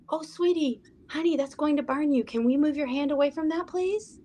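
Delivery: put-on high, sweet coaxing voice to a child; from the heart. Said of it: "from my heart would be like".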